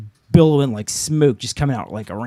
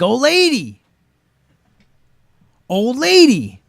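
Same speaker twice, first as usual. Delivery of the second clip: calling up high and loud; yelling up; 10 semitones higher, 5 dB louder, slower than usual. Retold or imitated. Man on the street yells up to the old lady, calling out. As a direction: shout up to a third-floor window. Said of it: "So I yell up to the old lady".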